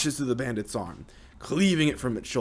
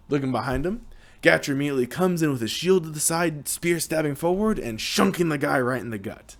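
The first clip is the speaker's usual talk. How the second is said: ordinary talk, nothing marked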